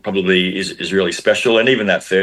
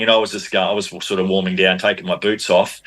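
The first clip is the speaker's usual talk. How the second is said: normal talking voice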